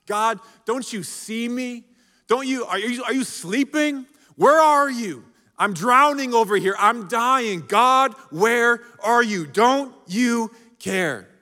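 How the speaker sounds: anguished prayer, slowing at the end; desperate pleading. Acted. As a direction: beg like you're drowning.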